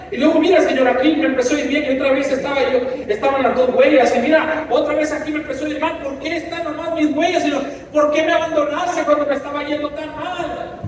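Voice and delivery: lightly voiced reproach to the Lord; man in the footprints story reproaching the Lord, anguished; anguished complaint. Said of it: "el hombre le dice".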